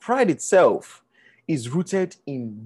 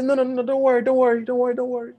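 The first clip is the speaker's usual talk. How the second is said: high, rapid repeated refusals; acted flustered polite refusal; brushing off help; 9 semitones higher, faster than usual. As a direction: chant it away in a higher voice. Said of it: "When they say".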